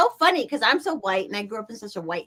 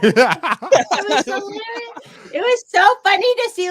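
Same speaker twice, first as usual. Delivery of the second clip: laughing; 9 semitones higher, 7 dB louder, slower than usual. Real, not acted. Laughing recounting.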